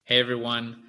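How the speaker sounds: ordinary talk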